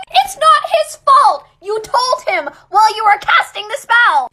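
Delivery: loud, high, indignant scolding; mad. Real, not acted. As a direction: tell him off at full volume. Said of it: "I'm mad".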